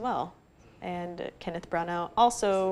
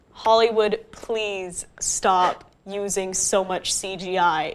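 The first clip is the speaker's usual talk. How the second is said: slow, spaced-out appeal, word by word; emphatic plea; 5 dB louder, slower than usual. Real, not acted. Critic appealing to Hollywood over editing, slow emphatic plea. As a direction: plead slowly, landing on each word.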